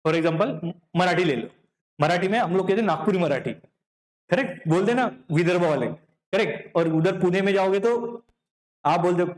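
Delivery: ordinary talk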